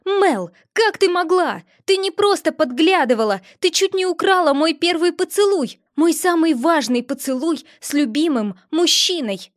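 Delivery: shouting. A narrator's reading. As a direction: scream the accusation at her friend. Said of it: "и закричала".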